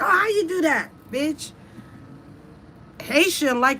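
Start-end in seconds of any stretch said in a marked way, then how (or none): 0.0-1.5 impressed disbelief. Retold or imitated. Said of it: "People are like".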